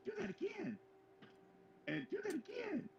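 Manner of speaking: very high mock kid voice; high-pitched child impression; imitating excited kids. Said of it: "the kids that were in there goes".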